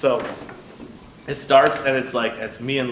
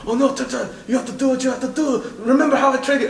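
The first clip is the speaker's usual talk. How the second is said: much higher, louder hammed-up plea; Van Damme pleading; 13 semitones higher, 5 dB louder than usual. Van Damme on screen urging his turtle during the raid, high hammed-up plea. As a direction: urge the turtle on in a mock-heroic squeal. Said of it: "and he's like".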